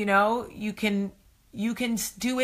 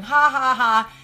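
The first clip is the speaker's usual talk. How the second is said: mocking laugh; 4 semitones higher, 6 dB louder than usual. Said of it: "everybody else that's reading the comment is going".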